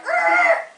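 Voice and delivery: very high, loud mock scream breaking into a laugh; scared yell. Dad seeing the bloody woman in the mirror, laughing mock scream. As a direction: scream in fright, laughing at it yourself. Dad sees bleeding ghost.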